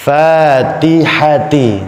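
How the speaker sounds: FATIHATI slow with light "æ" vowels; thin, smiling. Shown as a demonstration. Teacher correcting the thick reading, thin Fatihati. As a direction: draw out Fatihati with spread lips. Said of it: "tidak dijumpai huruf-huruf tebal".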